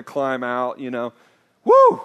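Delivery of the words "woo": high, loud mock child's whoop; imitated tantrum whoop. Child going nuts in the shopping cart, imitated shrill whoop. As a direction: let out a sudden wild squeal.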